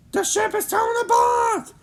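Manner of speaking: very high, loud alarm; panicked cry. Acted. Friends falling from the sky, panicked high cry. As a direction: scream the danger out. Ship breaking apart.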